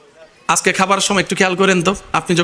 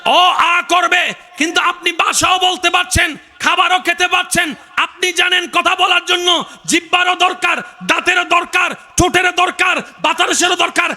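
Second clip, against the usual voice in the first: full-force preaching; 9 semitones higher than usual. Acted. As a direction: hammer home each point. (জোরালো উঁচু গলায় ওয়াজ)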